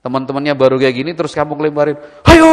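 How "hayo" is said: sudden high loud "Hayo!" to startle; prank scare shout. Retold or imitated. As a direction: jump out and yell "Hayo!". Scaring a friend as prank. (teriakan mengagetkan teman)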